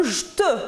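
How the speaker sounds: "je te" squeezed into "j'te"; dropped E. Shown as a demonstration. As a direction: say "je te" as one syllable, no E after the J. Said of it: "qui modifie un petit peu la prononciation".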